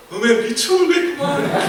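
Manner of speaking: high, laughing mock sleep-talk in Jeolla dialect. Pastor imitating roommate sleep-talking in Jeolla dialect, laughing. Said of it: "사투리를 쓰면서 잠꼬대를 하더라는".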